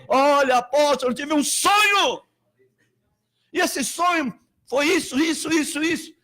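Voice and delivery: excited caller. Retold or imitated. A caller telling the apostle a dream, excited. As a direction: rush to share the dream. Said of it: "me liga alguém".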